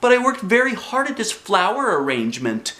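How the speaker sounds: put-on high pleading child voice; whiny kid protest. A narrator's reading. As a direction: protest like a pouting little girl. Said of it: "I said".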